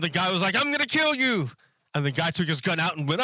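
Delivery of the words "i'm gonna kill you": high-pitched robber's threat, acted out; mock threat. Acts out robber threatening clerk.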